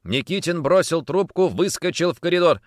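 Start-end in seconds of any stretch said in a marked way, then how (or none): none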